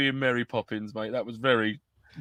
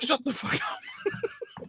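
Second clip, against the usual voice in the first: laughing; 8 dB quieter, much slower than usual. Real, not acted. Laughing protest at co-host.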